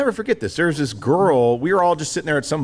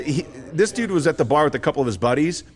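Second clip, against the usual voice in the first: ordinary talk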